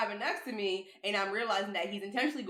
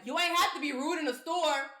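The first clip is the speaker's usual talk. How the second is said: mildly raised, higher mock complaint; higher-pitched retold call across lanes; imitated calling out; 7 semitones higher, 3 dB louder than usual. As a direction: imitate a man griping from his car. Man calling out from car window.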